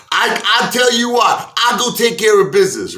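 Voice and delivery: high, loud put-on tough guy; tough talk. Pryor heading to confront the gangsters, loud put-on bluster. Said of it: "He goes".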